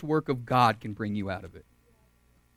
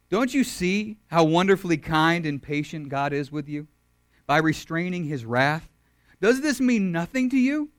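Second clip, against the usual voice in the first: scripture reading; 3 semitones higher than usual. Shown as a demonstration. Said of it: "let's go to Romans two four".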